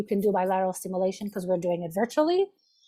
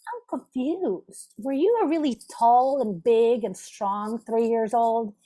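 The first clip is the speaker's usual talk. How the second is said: mock confusion; slower than usual. Shown as a demonstration. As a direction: play dumb to make the point, slowly. Therapist feigning puzzlement to client.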